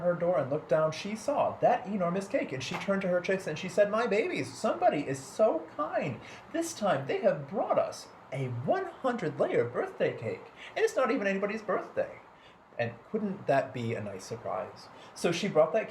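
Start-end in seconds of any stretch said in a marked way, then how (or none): 3.9-12.1 lightly voiced mother hen, delighted; a bright, higher clucking mother. A narrator's reading. Mother hen telling her chicks, delighted and high-pitched.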